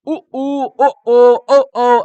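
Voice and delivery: उ then loud ओ from the throat; back vowel demo. Shown as a demonstration. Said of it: "इधर से निकल रहा है". (कंठ से ज़ोरदार ओ)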